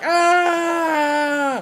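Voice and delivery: high, loud, sustained mock scream; imitated long scream. Reviewer imitates the film's victim, high mock scream. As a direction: let out one long hammed-up shriek. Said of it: "of course she's like".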